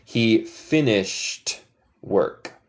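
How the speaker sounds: "He finished" drawn out slowly; slow, careful "He finished work"; slow model sentence. Shown as a demonstration. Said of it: "Él terminó su trabajo".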